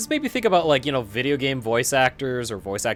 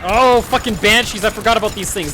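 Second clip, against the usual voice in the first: excited; 7 semitones higher, 7 dB louder than usual. Real, not acted. Spots favourite enemy in game.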